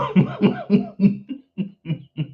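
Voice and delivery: long helpless giggle; real laughter. Real, not acted. Comedian cracking up at her own roast, real laughter. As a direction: laugh till it tails away.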